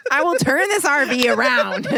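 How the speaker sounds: loud, high hammed-up shout; over-the-top playful warning; mock threat. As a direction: yell it like a parent at the wheel. Mock parental threat to turn around.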